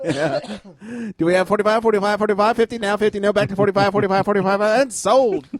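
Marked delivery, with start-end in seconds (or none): 1.2-5.5 rapid auctioneer call, higher. Pretend. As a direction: rattle off bids like an auctioneer. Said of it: "sounds like an auctioneer".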